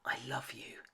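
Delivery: soft unvoiced whisper. A narrator's reading. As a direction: breathe it gently to him. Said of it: "Then she whispered".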